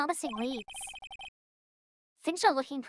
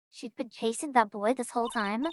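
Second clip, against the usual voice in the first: usual speaking voice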